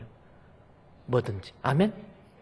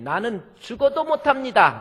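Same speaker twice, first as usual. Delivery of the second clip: higher, loud flat refusal; stubborn refusal; 11 semitones higher, 5 dB louder than usual. Retold or imitated. One pastor at the seminar refusing to call his wife, stubborn refusal. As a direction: dig in and refuse outright. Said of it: "어떤 한 사람이 손을 들더니".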